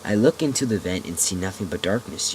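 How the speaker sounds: usual speaking voice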